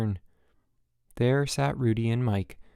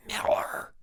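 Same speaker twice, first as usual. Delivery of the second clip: hissed whisper of the name; 3 dB quieter than usual. A narrator's reading. Counselor whispering angrily at camper. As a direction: whisper it sharply, angry but hushed. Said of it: "the counselor was whispering".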